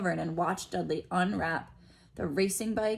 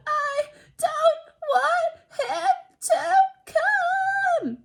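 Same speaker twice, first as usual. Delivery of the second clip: very high, loud, slow mock wail; hammed-up pretend crying tantrum; fake-sob yell; 8 dB louder, much slower than usual. A narrator's reading. Dudley fake-crying to his mother, loud hammed-up wailing. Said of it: "yelled between huge pretend sobs".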